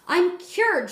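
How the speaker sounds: high, loud cry of relief; girl thrilled to be cured; joyful shout. A narrator's reading. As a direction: shout it out with joy. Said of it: "she shouted".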